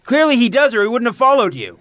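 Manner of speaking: loud, high, insistent rebuttal; heated arguing. Acted. Friend argues with Pally about little brother, heated. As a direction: press the point over their shouting. Acted quarrel, arguing back.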